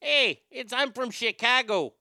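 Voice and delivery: hammed-up Chicago accent; Dan Aykroyd. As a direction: announce yourself as a proud Chicagoan. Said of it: "does a great Chicago accent".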